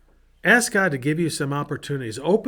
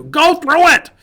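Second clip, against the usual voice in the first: much higher and louder, a sudden cry; rousing shout; 13 semitones higher, 10 dB louder, slower than usual. Real, not acted. Pastor urging viewers through the open door, rousing shout. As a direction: fling it out like a rallying call.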